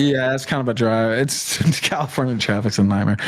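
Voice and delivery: low, put-on voice, parroting the excuse; lightly voiced mock of a friend; mocking echo. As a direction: repeat his excuse back in a mock voice. Mocking Vito's excuse back.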